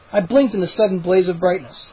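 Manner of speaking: ordinary delivery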